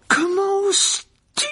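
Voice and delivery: very high, drawn-out shriek; loud, stretched howl of indignation; outraged scream. Acted. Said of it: "hurla Alicia".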